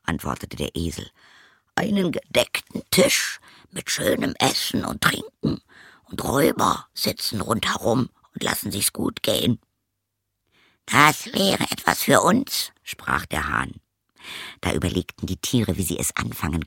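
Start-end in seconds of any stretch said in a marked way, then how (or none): 1.7-9.6 high, airy donkey voice; donkey character. Acted. Donkey reports the robbers' feast, high donkey voice. 10.9-12.7 high, slow rooster voice; rooster character. Acted. Rooster longs for the robbers' feast, high rooster voice.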